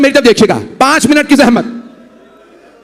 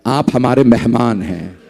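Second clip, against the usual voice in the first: smiling reply; 7 semitones lower than usual. A narrator's reading. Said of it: "मौला ने मुस्कुरा के कहा".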